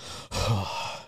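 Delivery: long voiceless exhale; soft, worn-out breath release; drained sigh. Shown as a demonstration. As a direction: sigh out as if spent. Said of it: "fucking drained afterwards".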